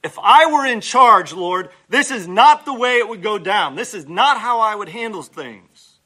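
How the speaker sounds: loud, high know-it-all protest; indignant complaint. Acted. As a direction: tell God you'd do better. Own complaint to God, acted.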